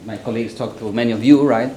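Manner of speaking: usual speaking voice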